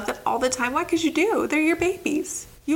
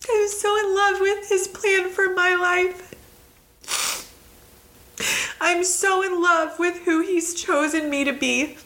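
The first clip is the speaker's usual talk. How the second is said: high, emotional, overcome with devotion; choked up with love; 6 semitones higher than usual. Real, not acted. Speaker apologising as love for the Lord overwhelms her, choked up. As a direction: fight back tears while declaring love.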